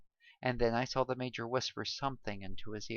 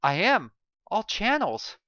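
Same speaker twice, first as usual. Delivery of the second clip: louder, higher, strained answer; tense reply; 9 semitones higher, 8 dB louder than usual. A narrator's reading. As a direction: snap back that you're trying. Kevin answering under pressure.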